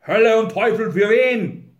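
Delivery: loud, high, angry cursing; furious outburst. A narrator's reading. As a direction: explode with exasperation. Grinley curses at Wolf's excuse.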